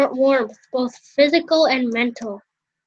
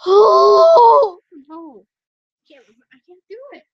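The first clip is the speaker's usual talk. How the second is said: dying man voice; 5 semitones higher, 8 dB louder than usual. Acted. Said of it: "I'm trying to do a dying man voice".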